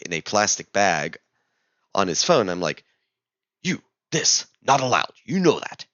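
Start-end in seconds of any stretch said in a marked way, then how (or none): 3.6-5.9 a hint of an accusing guard in broken English, higher. Guard accuses traveller over a banned item, lightly voiced broken English. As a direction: scold him in halting English. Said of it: "in very broken English".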